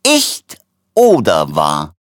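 very slow words broken by coughing; hüstelnd. A narrator's reading. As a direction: cough your way through the exclamation. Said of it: "rief der Doktor hüstelnd". (hüstelnd und stark gedehnt)

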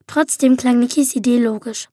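ordinary delivery, nothing marked

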